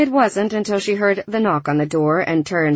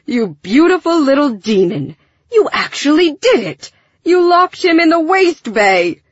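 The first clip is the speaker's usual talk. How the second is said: higher, louder delighted exclamation; gleeful amazement; 8 semitones higher, 5 dB louder than usual. A narrator's reading. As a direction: crow over a friend's outrageous prank. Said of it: "doubled over laughing".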